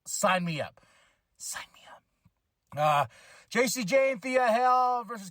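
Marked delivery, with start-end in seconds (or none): 1.4-2.0 breathy whispered echo. Shown as a demonstration. Echoing his own eager sign-up as a whisper. Repeats it as an aside.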